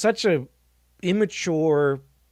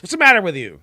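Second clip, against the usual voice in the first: mock scolding; 4 semitones higher, 7 dB louder, faster than usual. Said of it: "And a little smack in the face".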